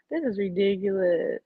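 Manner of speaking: normal talking voice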